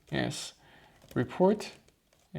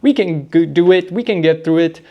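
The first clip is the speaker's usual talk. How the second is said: fast pep talk; 11 dB louder, much faster than usual. Real, not acted. Hypes herself up, rushed.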